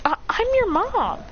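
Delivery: high, feminine put-on voice; high-voiced mother figure; stammering mother. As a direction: stammer the reveal in high pitch. Kushina reveals herself.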